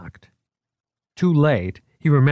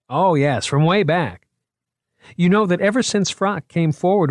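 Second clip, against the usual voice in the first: put-on higher, eager young curator showing off his exhibit; a keen museum man pitching his show, higher and lively; 6 semitones higher, 3 dB louder than usual. A narrator's reading. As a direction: sell the exhibit with boyish enthusiasm. Moriarty explains, apologises.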